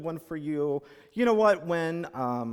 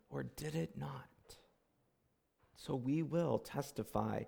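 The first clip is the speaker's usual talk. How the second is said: solemn reading; 3 semitones lower, 8 dB quieter than usual. Acted. Minister opening the service, soft reverent reading. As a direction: read it like a prayer to a hushed room.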